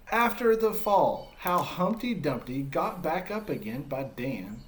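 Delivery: put-on storytelling to kids as Humpty, lively and expressive; story narration. Teacher reading Humpty's story to children, expressive narration. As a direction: read it like a picture book to a class. Reads picture book to children.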